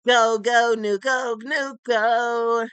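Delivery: cheering. Acted.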